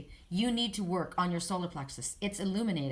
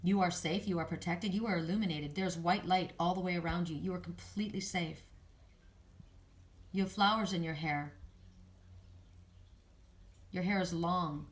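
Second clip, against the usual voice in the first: calm meditative affirmations; guided meditation; 3 dB quieter than usual. Acted. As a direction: gently guide the listener inward.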